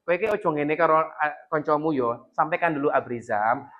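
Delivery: put-on soft slow coaxing to a child; patient, tender parent voice; gentle advice. Shown as a demonstration. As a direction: talk the child round softly, slowly. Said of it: "jangan langsung bilang".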